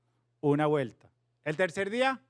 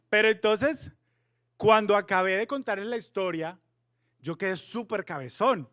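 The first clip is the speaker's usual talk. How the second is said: ordinary talk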